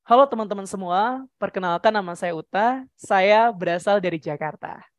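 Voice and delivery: ordinary delivery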